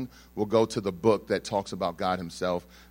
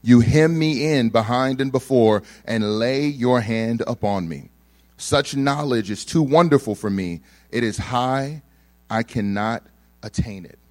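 loud, slow, solemn scripture reading; 9 dB louder than usual. Acted. Preacher reads Psalm 139 aloud to the congregation, solemn and loud. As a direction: proclaim the psalm slowly to the congregation.

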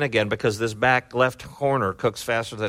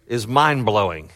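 normal talking voice

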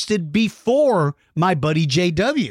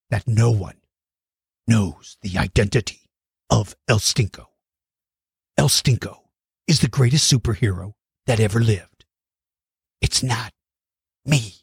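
dramatic movie-trailer voice; 8 semitones lower than usual. Pretend. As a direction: intone it like a mysterious legend. Dramatic mystery-hero voice again.

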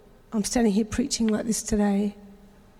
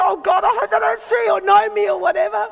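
panicked prayer; 8 semitones higher, 5 dB louder than usual. Retold or imitated. Panicked thought while screaming in car.

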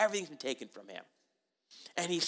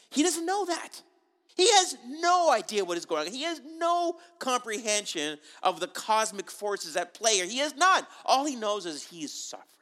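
much higher and louder, pleading insistence; impassioned emphasis; 6 semitones higher, 5 dB louder than usual. Real, not acted. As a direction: push it up high and hard for the congregation. Preacher emphasizing Job's ignorance.

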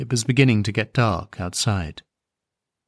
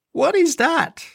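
put-on high small child asking; a little girl on the train pointing at the rabbit, high child voice; child's voice. A narrator's reading. Voice it like a curious small girl.

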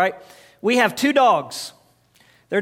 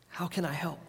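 much softer, gentle offer; soft unison reply; 3 semitones lower, 14 dB quieter than usual. Shown as a demonstration. Pastor and church quietly repeating the kind answer. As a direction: say it quietly together. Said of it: "say it with me".

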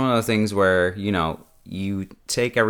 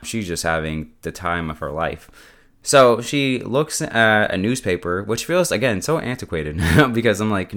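usual speaking voice

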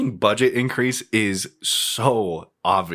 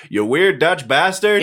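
slow, higher, louder sneer; Dr. Evil voice; 8 semitones higher, 5 dB louder, slower than usual. Retold or imitated. Dr. Evil insulting Goldmember, slow loud sneer. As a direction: draw out the insult with villain relish. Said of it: "Dr. Evil just says".

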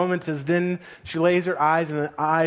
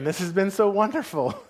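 ordinary delivery

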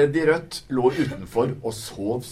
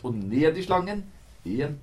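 ordinary delivery, nothing marked

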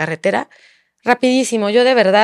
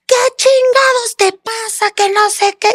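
much higher, slow mock yell of the furious husband; imitating angry husband; 13 semitones higher, slower than usual. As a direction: mimic him exploding on the phone. Husband furious at Instagram messages. (imitando al esposo furioso, mucho más agudo y lento)

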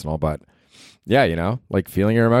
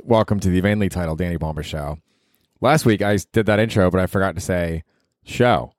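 ordinary talk, nothing marked

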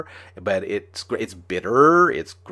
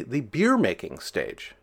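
usual speaking voice

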